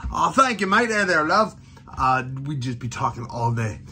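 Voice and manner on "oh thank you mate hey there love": a light, higher, cheery mock British vendor; lightly hammed-up olde-time stall keeper; Renaissance fair hawker. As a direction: greet passing fairgoers like a jolly market seller, lightly played. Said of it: "we'd be hawking to our customers".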